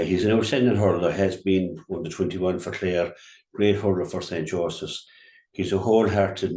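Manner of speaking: ordinary talk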